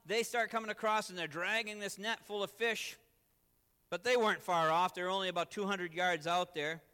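loud, driving sermon storytelling; fervent preaching. Real, not acted. Preacher telling of Peter's leap, fervent preaching.

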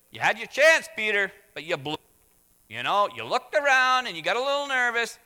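loud, high mock scolding; scolding rebuke. Preacher voicing a harsh rebuke of Peter, loud scolding. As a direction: tear into him for blowing it.

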